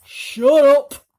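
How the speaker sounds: loud, harsh snap; snapping. Acted. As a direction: cut the boy off hard. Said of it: "Aunt Sponge had snapped".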